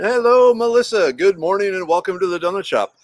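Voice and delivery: high, excited greeting easing down; excited welcome. Real, not acted. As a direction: greet a newcomer with delighted excitement. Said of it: "I got excited because we have a new entrant".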